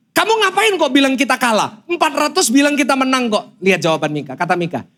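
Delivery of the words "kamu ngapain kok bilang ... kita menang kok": higher-pitched, mildly acted king's complaint; lightly voiced king challenging his prophet; indignant king. King questioning the prophet Mika about defeat, indignant. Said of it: "Kan raja bilang".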